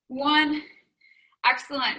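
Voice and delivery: ordinary talk, nothing marked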